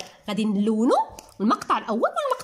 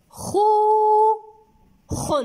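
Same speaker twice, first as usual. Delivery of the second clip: خوخ as long high loud خو, then short خن; almost sing the first syllable of خوخ, pause, drop the second; 10 semitones higher, 6 dB louder, much slower than usual. Shown as a demonstration. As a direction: stretch خوخ into two separate syllables. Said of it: "إنه".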